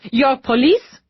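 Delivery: put-on brisk, clipped French-accented question. A narrator's reading. Lisette demanding of the police officers, brisk French accent. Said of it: "brisk tone made exotic by the French accent".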